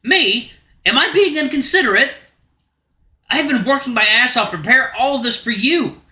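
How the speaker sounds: angry outburst. Acted. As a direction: let the hurt boil over into anger.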